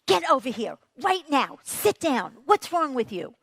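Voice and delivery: sharp, loud scolding parent. Retold or imitated. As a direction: snap at the child to sit down. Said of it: "This is what you hear".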